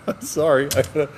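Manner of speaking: ordinary talk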